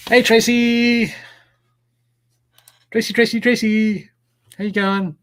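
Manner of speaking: hailing Tracy across the room; excited greeting. Real, not acted. Greeting a friend joining live.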